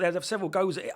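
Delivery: ordinary talk, nothing marked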